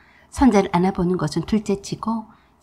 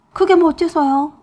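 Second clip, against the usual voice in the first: higher, louder defiant comeback; defiant retort; 7 semitones higher, 6 dB louder than usual. A narrator's reading. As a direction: throw it back defiantly. Defiant daughter-in-law.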